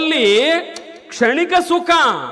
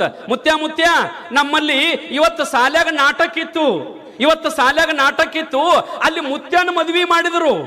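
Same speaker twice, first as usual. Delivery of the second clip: higher, laughing schoolboy taunt; teasing schoolboys; 8 semitones higher than usual. Retold or imitated. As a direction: tease the old man with a giggle. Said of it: "ನಗಚಾರಿಕೆ ಮಾಡಿ".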